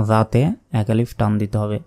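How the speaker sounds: ordinary delivery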